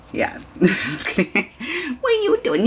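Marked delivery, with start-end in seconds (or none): 2.0-2.7 very high coo at a puppy. Real, not acted.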